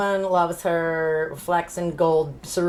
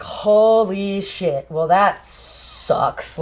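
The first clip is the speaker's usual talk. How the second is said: very slow, stretched words; drawn-out reaction; much slower than usual. Acted. [name] reacts to Mal's death, slow drawn-out delivery. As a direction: drag each word out in mock dismay.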